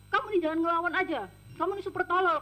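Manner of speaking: retold scolding. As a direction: imitate a boss snapping at back-talk. Retold employer scolding the maid.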